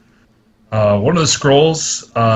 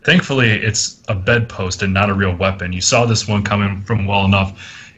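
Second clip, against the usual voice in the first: normal talking voice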